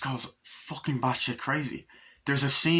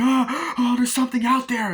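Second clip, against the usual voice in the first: high, loud imitated terrified cry; mock panic; 12 semitones higher, 6 dB louder than usual. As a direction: burst in like a panicked horror victim. Acts terrified girl bursting in.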